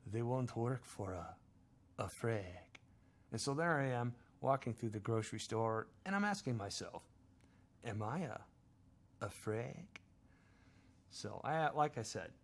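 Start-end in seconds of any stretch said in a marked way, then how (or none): 0.1-2.8 put-on slow, heavy Chechen accent with freak said as frig; slow Chechen gangster accent. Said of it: "And the Chechen says". 7.8-9.8 inner voice in a mock Chechen accent, freak said as frig; a light Chechen accent on frig. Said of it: "crazy accent for the little voice in my head".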